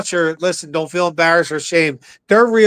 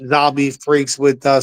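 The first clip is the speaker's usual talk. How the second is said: ordinary delivery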